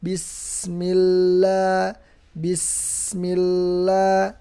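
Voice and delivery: bismillah slow, lam and sin both held. Shown as a demonstration. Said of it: "ditahan lamnya dan sinnya". (bismillah dengan lam dan sin ditahan)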